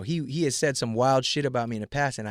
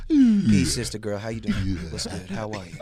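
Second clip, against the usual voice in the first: mellow, lowered greeting after hype; suddenly calm; 5 semitones lower than usual. Retold or imitated. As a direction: switch to a chilled, easy voice. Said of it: "I went like this".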